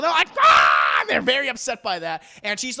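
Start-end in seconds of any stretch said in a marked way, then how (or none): 0.4-1.0 loud mock shriek of offence; outraged shriek. Buzzard women are very upset by the insult, mock screaming. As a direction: let out a big hammed-up scream.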